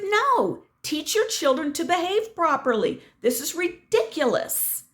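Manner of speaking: high-pitched exasperated scolding; fed-up, indignant comeback; retold indignant retort. Retold quarrel, repeats her retort.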